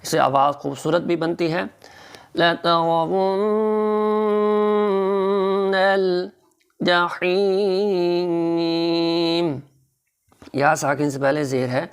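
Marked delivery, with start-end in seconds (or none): 2.4-9.6 very slow melodic recitation, nasal hum on the doubled nun; slow Quran chant with nasal ghunna. Shown as a demonstration. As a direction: draw out لترون with a humming nasal. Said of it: "ناک میں آواز گنگنانا ہے".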